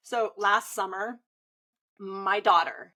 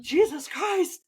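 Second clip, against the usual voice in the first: higher, slow, overwhelmed "Jesus Christ"; stunned exclamation; 7 semitones higher, slower than usual. Retold or imitated. Mother reacting to her daughter's fear, stunned. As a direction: let it out slow, floored.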